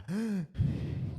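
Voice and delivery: high, soft intake of air; sharp gasp. Retold or imitated. Acting out getting breath back, sharp gasp.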